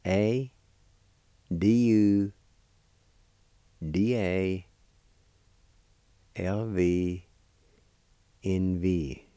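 letters in measured groups, well spaced; letter-by-letter spelling. Shown as a demonstration. Teacher spelling a word for learners, letter by letter. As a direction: spell it out slowly with long pauses. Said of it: "this is spelled like this".

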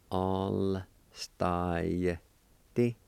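"All style T" stretched out, T set apart; slow syllable-by-syllable. Shown as a demonstration. Teacher showing the Y sound, slow syllable-by-syllable. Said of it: "like this".

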